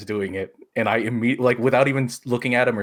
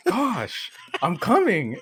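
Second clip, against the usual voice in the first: higher, huffy impatience; dad pretending he's coming, with a huffy high voice; mock annoyed brush-off; 12 semitones higher, much slower than usual. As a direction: play the dad fobbing her off, high-pitched. Dad brushing off mom's request.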